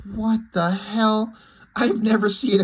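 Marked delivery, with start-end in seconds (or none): 0.0-1.3 slow, drawn-out incredulous reaction; slowly drawn-out what the hell; stunned disbelief. Retold or imitated. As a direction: let the disbelief stretch out the words. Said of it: "I'm like".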